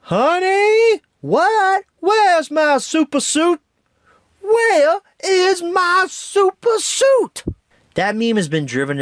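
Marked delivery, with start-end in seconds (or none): 0.0-3.6 much higher, loud frantic shout, rising; Frozone meme voice. Retold or imitated. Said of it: "without mentioning". 4.4-7.4 much higher, very slow drawn-out loud shout, falling; Frozone meme voice. Retold or imitated. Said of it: "without mentioning".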